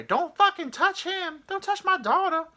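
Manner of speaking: very high, loud mock yell; hammed-up warning in a piercing, slowed-down woman's voice; outburst of angry woman. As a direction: screech it like an outraged mother. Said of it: "all of a sudden I hear".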